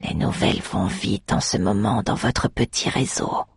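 mock her from the dark; deep gravelly pit fiend. Undead Dermot Cal speaks.

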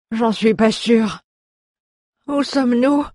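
high-pitched, groggy dwarf voice; grumbling dwarf. A narrator's reading. Dwarf just revived, asking his rescuer, grumbling. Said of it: "grommela le nain".

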